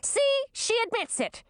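very high, triumphant princess; high, put-on tattling voice; spiteful sister. A narrator's reading. Sister scores a point in quarrel.

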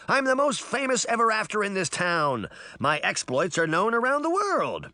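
put-on high, affronted boy bragging; outraged boasting cry. A narrator's reading. Boy telling girls of his fame, indignant. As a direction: cry it out high with wounded pride. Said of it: "the boy cried".

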